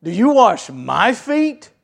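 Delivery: sarcastic. Retold or imitated. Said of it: "Peter sarcastically goes".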